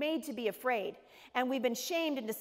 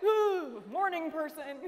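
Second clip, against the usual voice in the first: mock dismay; 6 semitones higher, 3 dB louder, much slower than usual. Her dismayed reaction to cheery seatmate.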